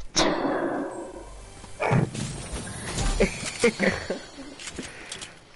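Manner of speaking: loud bursting laugh; burst of laughter. Real, not acted. Laughing after accusation.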